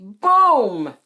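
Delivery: loud, falling sound-effect word; sudden boom. A narrator's reading. Narrator startling listeners with noise backstage, loud boom. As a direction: burst out with a big crash.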